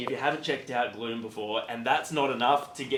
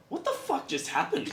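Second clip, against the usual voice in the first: shocked; 13 semitones higher than usual. Retold or imitated. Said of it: "see you on stage and go".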